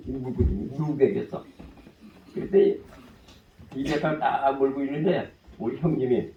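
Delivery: ordinary talk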